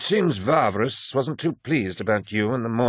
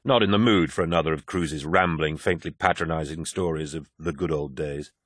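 ordinary delivery, nothing marked

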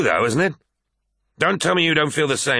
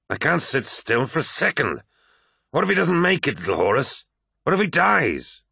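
higher-pitched, distraught outburst; agitated worry; 6 semitones higher than usual. A narrator's reading. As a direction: let the worry climb into anguished questions. Abaddon agitated, fearing for commander.

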